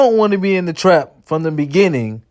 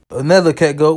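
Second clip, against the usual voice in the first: usual speaking voice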